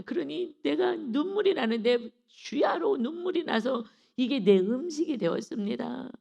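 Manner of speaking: normal talking voice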